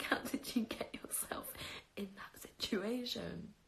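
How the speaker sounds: unvoiced whisper, slowing down. Viewers reacting to her story, in a whisper. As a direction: hush it out in disbelief.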